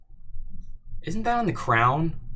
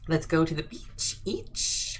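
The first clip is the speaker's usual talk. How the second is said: mock beach lover; 4 semitones higher, 3 dB quieter, slower than usual.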